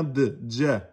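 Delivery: ja said as dja, with a clear d in front; d before the j. Shown as a demonstration. Teacher insisting on the d, dja. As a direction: start ja with a d, dja. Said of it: "je mets bien le D J devant".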